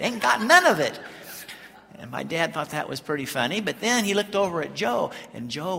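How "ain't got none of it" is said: loud, high Southern granny outburst; imitated complaining Grandma. Grandma about her husband, imitated emphatic complaint. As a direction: hammer it home like a feisty old wife. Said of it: "she said".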